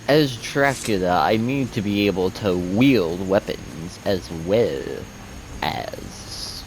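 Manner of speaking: put-on slow, drawn-out Dracula accent. Streamer role-playing a vampire lord to viewers, hammy Dracula accent. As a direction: ham up a Dracula accent, stretching the words. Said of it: "bring out the accent for there".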